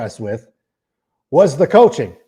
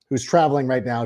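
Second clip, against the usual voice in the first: usual speaking voice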